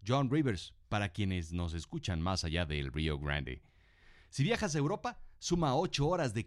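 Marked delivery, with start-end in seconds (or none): 0.9-3.6 low aside voice. Acted. Aside for listeners up north.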